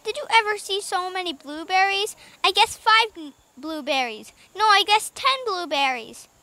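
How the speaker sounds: high-pitched eager chatter; excited cry. A narrator's reading. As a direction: exclaim like an amazed little boy. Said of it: "cried Benny".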